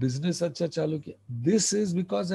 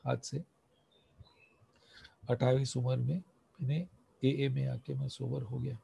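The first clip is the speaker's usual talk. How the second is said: lower, softer voice for a recovering alcoholic's story; sample confession; 5 semitones lower, 4 dB quieter than usual. Shown as a demonstration. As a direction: tell it low and soft, as a sharing at a meeting. Demonstrates telling his story to alcoholic. (धीमी, नीची आवाज़ में अपनी कहानी सुनाना)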